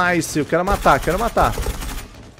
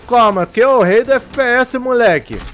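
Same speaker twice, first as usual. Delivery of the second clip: hype boast; 7 semitones higher, 6 dB louder than usual. Real, not acted.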